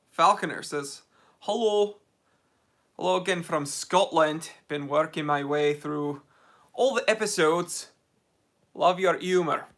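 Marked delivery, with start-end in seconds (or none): 1.4-9.7 amateur attempt at a Scottish accent. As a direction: put on a rough Scottish accent. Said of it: "That's my Scottish accent".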